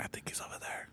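soft, breathless gamer whisper; hushed callout. Retold or imitated. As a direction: whisper the callout so she can sleep. Gaming whisper after lowering volume.

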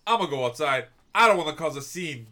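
loud, fed-up announcement. Retold or imitated. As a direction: say it loud enough for the whole store to hear. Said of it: "but being loud".

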